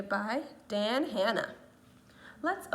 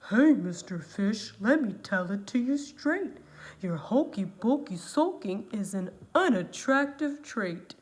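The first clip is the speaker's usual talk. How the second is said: blunt octopus; 8 dB louder than usual. Acted. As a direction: tell it to him straight like a gruff octopus. Octopus voiced lower.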